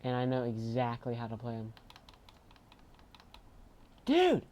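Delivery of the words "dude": outraged yell. Real, not acted. Sudden game upset.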